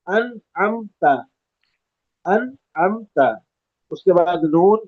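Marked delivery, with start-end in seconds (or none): 0.1-3.2 higher انعمت, clean hamza then mid-throat ع; hamza then ain. Shown as a demonstration. Teacher modelling the correct word, hamza then ع in انعمت. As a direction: start انعمت light, squeeze the ع after the n. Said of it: "پہلے حمزہ کی آواز آئے گی".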